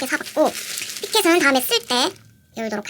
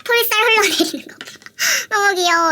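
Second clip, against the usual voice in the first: higher, louder gushing; excited gush; 6 semitones higher, 5 dB louder than usual. Real, not acted. Showing viewers the droopy cheeks, loud high gush.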